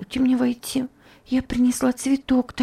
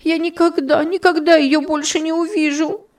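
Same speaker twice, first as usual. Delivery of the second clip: put-on orphan girl sobs for her lost friend; sobbing lament; 8 semitones higher than usual. A narrator's reading. High sobbing lament. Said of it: "И она зарыдала вновь".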